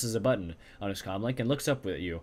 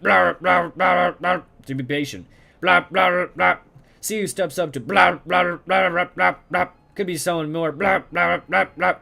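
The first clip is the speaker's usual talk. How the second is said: high, loud blah-blah chatter through machine noise; muffled overheard voices; 5 semitones higher, 7 dB louder than usual. Acted. Eavesdropping on muffled voices, high, loud blah-blah chatter. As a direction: babble the words like voices half heard through a wall. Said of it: "A nasal woman who sounds like a heavy smoker".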